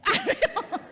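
high-pitched laughing admission; helpless giggle in a high voice. Real, not acted. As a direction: laugh right through the words. Laughing over an unreadable word.

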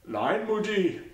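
lightly voiced, cowed son; son's voice, meek. A narrator's reading. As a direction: answer like a scolded boy.

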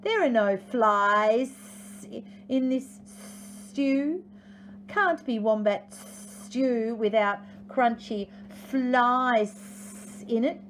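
very slow, long hissed s sounds; drawn-out, sibilant complaint; hissing lizard. Acted. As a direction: stretch every s like an old lizard. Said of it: "he hissed".

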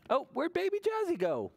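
put-on high, wondering question to the kids; mock surprise. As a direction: pretend to be puzzled, up high. Reading hide and seek story.

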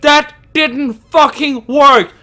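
high, loud, slow enraged shouting; furious yell. Real, not acted. Streamer whose hiding failed, yells furiously. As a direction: yell when the plan fails.

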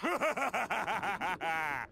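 a short villain cackle of triumph; gloating laugh. Acted. Plankton gloating to Karen, mock evil laugh. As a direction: laugh at your own coming victory.

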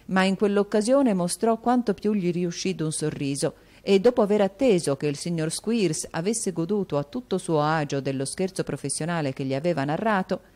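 plain reading voice

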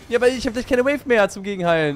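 loud, high frantic protest; yelling across the room. Real, not acted. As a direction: shout the complaint mid-fight. Hektischer Teamfight, Beschwerde. (laut und gehetzt rufen)

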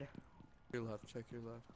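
quiet callout. Real, not acted. Calling out quietly to teammate.

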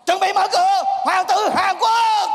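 high, drawn-out stage reveal; ringing magician's introduction; showman's announcement. Acted. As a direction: announce it big, stretching the final name.

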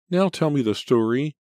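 put-on high, loud child's plea; high-pitched, insistent kid voice; demanding little boy. A narrator's reading. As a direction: nag like a small child at bedtime. Sleepy boy demands bedtime story.

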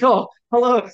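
mock surprise. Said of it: "I was like".

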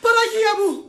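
high, startled cry; panicked exclamation; shocked alarm. Acted. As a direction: cry out in horror at the news. Shocked by disastrous news.

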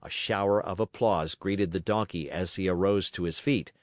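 ordinary delivery

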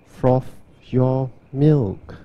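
ordinary talk, nothing marked

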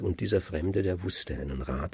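plain reading voice

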